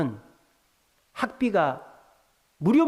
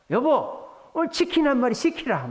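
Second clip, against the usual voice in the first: delighted dad; 9 semitones higher, 3 dB louder than usual. Retold or imitated. Happy dad orders chicken.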